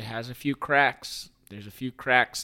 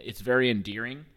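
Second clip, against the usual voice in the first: ordinary talk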